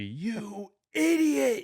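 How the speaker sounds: high, slow, drawn-out angry yell. Retold or imitated. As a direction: yell at the player on screen like he blew it. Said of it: "I was yelling at my T V".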